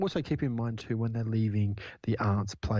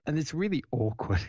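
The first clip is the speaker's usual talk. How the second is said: ordinary talk, nothing marked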